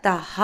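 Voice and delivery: തഹ ending in a sounded "ha"; visarga pronunciation. Shown as a demonstration. Teacher showing learners the visarga after ta, തഹ with clear "ha". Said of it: "ഉച്ചാരണം വരുന്നത്".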